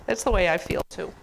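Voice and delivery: plain reading voice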